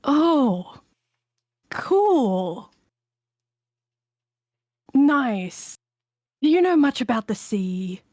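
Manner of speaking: stilted, with long gaps between words; awkward small talk. Acted. Scared character asks a stranger about the sea, awkwardly. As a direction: make halting, uneasy small talk. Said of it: "trying to make really awkward small talk".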